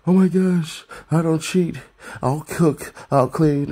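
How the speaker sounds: man begging a woman to pick him, in a mock high eager voice. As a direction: ham up a desperate guy promising everything. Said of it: "some man has to go ahead and go".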